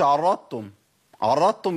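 عرضتم run together as 'arrattum', no ض; full merging, wrong. Shown as a demonstration. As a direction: swap the ض of عرضتم for a double t. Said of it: "ضغمت ضغم كامل".

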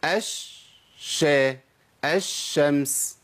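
الشمس very slowly as 'esh... shams'; drawn-out 'esh-shams', syllable by syllable; merged lam. Shown as a demonstration. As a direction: stretch the doubled SH in الشمس, no L. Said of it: "بشيل اللام واحط شين".

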